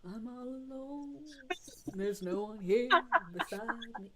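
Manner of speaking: lightly voiced sad cartoon donkey; Donkey from Shrek. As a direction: mope like a lonely talking donkey. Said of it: "sad donkey from Shrek".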